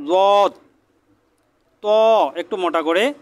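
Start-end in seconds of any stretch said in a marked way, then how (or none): 0.1-0.5 লদ said carefully and louder, tongue edge on the upper molars; careful dwad. Shown as a demonstration. Teacher stressing a careful লদ for students. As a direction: place লদ deliberately and firmly. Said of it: "একটু সাবধানতার সাথে উচ্চারণ করবেন".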